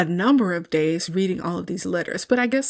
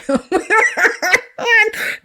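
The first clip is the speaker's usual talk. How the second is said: high, louder burst of laughter; real laugh; 13 semitones higher, 5 dB louder than usual. Real, not acted. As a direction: crack up at the line. After quoting Mister's dad.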